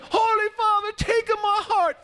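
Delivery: very high, stretched pleading yell; crowd yelling. Acted. Huge crowd yelling up at the Pope, very high and pleading. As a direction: shout it up like a whole crowd begging. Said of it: "Everybody yelling".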